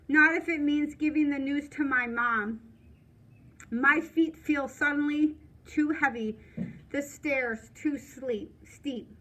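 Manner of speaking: usual speaking voice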